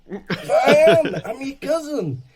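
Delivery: put-on high, laughing old lady insisting; old woman voice. Old woman at the photo call insisting, high laughing voice.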